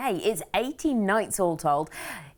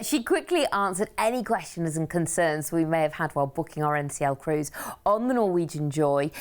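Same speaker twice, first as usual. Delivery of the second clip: usual speaking voice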